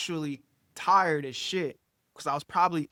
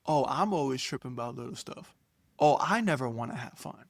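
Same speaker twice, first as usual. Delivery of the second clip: lower, incredulous repeat of an accusation; retold wounded sarcasm; sarcastic echo; 4 semitones lower than usual. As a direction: throw her words back, dropping the pitch. Echoing her accusations back at her.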